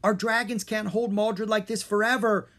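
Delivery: yelling to friends over a storm; yelling over wind. A narrator's reading. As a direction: shout over the roaring winds. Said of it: "Drake yelled".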